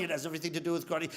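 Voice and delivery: low, soft, fast rebuttal; unmoved master, quick and low; sensei. Acted. Sensei contradicting his student, low quick reply.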